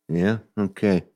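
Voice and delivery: lightly voiced surly musician, offhand; laconic mumble. Imitating [name] agreeing to play the club, offhand. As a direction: toss off a cool, noncommittal okay. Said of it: "talking like this".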